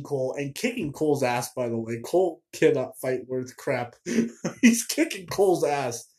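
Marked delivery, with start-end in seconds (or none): none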